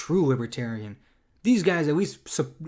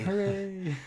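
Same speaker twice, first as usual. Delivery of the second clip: softer, higher half-hearted hooray; small feigned celebration, echoing a co-host; mock cheer; 5 semitones higher, 5 dB quieter than usual.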